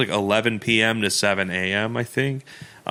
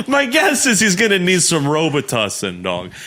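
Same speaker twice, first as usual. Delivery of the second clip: laughing through the words; chuckling while joking; 5 semitones higher than usual. Retold or imitated.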